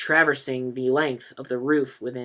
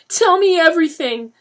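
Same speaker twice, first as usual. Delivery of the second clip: much higher, louder tearful demand; crying; 11 dB louder than usual. A narrator's reading. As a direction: demand it through tears. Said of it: "Lisa was crying now".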